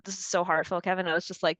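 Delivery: usual speaking voice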